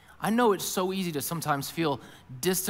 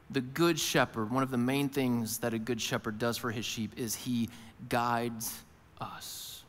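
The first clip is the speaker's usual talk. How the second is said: normal talking voice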